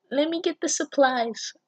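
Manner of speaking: put-on a high, girly read of the line; high girly character voice. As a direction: squeak the line like a timid schoolgirl. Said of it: "she just sounds like that in my head".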